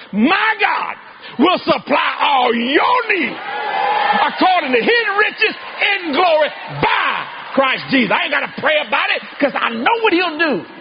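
shouting, drawn-out preacher's climax; full-force preaching. Acted. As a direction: preach it with everything, crying out.

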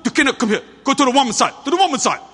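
loud, high, abrupt orders in imitation; hard, abrupt. Guards ordering a wife to the women's side, hard and abrupt. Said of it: "That's how they talk, real kind of hard, you know, abrupt".

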